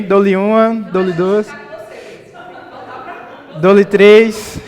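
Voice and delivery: loud auctioneer countdown. Pretend. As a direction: call it out like an auctioneer closing a sale.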